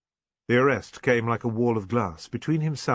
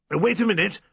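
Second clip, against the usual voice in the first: raised, higher voice to halt someone; loud call; 9 semitones higher, 6 dB louder than usual. A narrator's reading. Squire stops the departing policeman, calling loudly. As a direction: call out sharply to stop him leaving. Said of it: "when Jocelyn said loudly".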